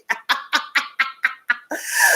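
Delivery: real burst of laughter; laughing. Real, not acted. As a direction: laugh at your own joke. Laughs at own impression.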